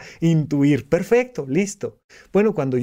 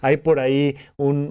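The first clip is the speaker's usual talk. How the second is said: ordinary talk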